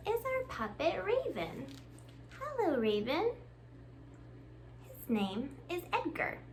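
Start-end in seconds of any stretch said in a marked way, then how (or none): none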